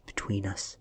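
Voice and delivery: ordinary delivery, nothing marked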